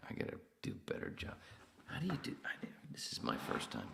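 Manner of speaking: hushed unvoiced aside; whispering. Real, not acted. Streamer muttering to himself about chat, whispered. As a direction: whisper it to yourself.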